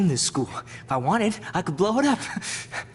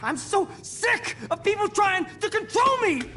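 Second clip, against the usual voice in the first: high, enraged shout; furious outburst; 9 semitones higher than usual. Acted. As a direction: lose it completely. Nathan snaps, waves gun.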